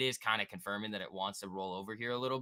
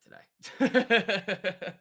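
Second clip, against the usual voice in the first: slow, laughing through the words; 12 semitones higher, 6 dB louder than usual. Real, not acted. Streamer cutting off a topic, laughing. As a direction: hold back laughter and fail. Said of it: "YouTube's gonna get so angry".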